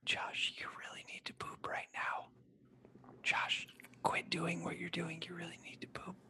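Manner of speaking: soft, breathless whisper of a prodding inner voice; mock inner whisper. Host parodies God speaking as a gut whisper, hushed mock urging. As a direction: whisper it like a secret urge from inside. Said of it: "more like a whisper and into my soul".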